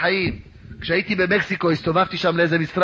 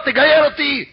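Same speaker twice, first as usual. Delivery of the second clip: high, insistent retold plea; tearful pleading; 9 semitones higher, 4 dB louder than usual. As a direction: beg as if crying from the heart. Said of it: "התחיל לבכות".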